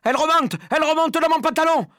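high, loud, frantic wail; panicked yell. A narrator's reading. Man with a mouse climbing his leg, yelling in panic. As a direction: shout it in rising horror. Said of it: "cria l'homme".

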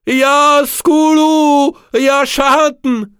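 invocation criée, très aiguë et lente; à gorge déployée. Acted. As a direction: clame vers la nef, extatique. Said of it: "à gorge déployée". (Pierre invoque à gorge déployée, très lent)

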